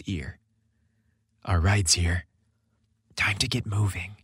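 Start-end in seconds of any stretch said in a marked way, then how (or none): 1.5-4.2 tender whisper. A narrator's reading. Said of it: "Chris whispered tenderly".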